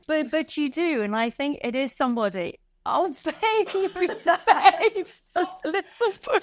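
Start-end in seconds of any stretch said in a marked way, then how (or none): 2.9-6.4 tender comfort. Real, not acted. Host comforts tearful guest.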